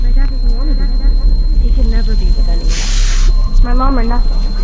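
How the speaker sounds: enraged shout of bitter words; bitter outburst. Acted. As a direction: hurl it out in bitter anger.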